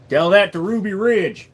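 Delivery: put-on country accent. Streamer answering chat about guns, mild country accent. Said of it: "I don't know why I'm country all of a sudden".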